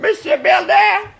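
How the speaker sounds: very high, drawn-out lovestruck name; swooning falsetto. Acted. Secretary swoons at the boss's name in a very high drawn-out voice. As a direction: melt on the name as if fainting.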